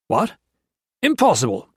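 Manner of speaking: sudden high outburst of disbelief; shocked. A narrator's reading. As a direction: jump up in pitch, incredulous. Jones feigns shock.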